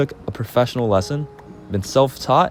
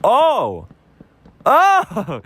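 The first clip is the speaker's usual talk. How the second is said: rising excited oh's, much higher each time; a click finally landing, cry it out higher and higher; delighted breakthrough yelps, pitched way up; 8 semitones higher, 3 dB louder, much slower than usual. Retold or imitated. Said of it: "I'd be like".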